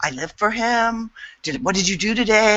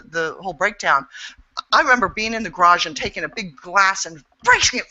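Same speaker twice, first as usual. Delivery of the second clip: usual speaking voice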